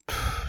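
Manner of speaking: soft puff of air; wordless, weary exhale; exasperated puff. A narrator's reading. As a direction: blow out a quiet "pff". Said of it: "zei Henke".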